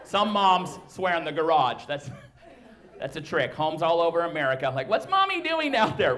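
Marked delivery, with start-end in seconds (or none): 4.9-6.1 put-on high-pitched curious kid voice; child's question. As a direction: ask dad in a high child's voice. Child asks about swearing mommy.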